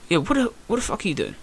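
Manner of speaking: softly imitated coach's reproach; restrained telling-off. Coach checking his fighter in the corner, restrained telling-off. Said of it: "it wasn't like a hairdryer".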